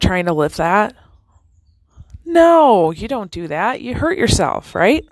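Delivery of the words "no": emphatic no. Real, not acted. Emphatic rhetorical answer.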